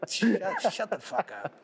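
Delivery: high, stunned shut the fuck up; half laughing in disbelief; disbelieving. Retold or imitated. Telling friend Jeff about Muska waiting, stunned disbelief.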